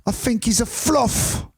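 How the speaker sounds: slow, high-pitched mock Londoner; London accent. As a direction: drag it out in comic London English. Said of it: "they do talk like that in London".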